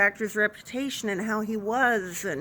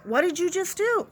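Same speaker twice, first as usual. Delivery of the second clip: raised, incredulous mock question; imitating a disbelieving nurse; 7 semitones higher than usual. Nurse's glare at the nurse who shut the door, voiced as a higher, disbelieving question. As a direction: sell the nurse's stare with a higher, scolding pitch. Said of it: "he's looking at me going".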